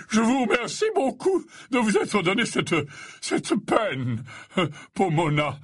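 high, effusive gentlemanly voice; courteous. A narrator's reading. Slughorn thanks Professor Sprout for her trouble, courteous. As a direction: thank her with fussy politeness. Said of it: "disait Slughorn d'un ton courtois".

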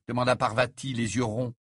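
ordinary delivery, nothing marked

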